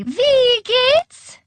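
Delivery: very high, loud, piercing call; shrill call. A narrator's reading. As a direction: sing out a shrill greeting from afar. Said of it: "calling shrilly".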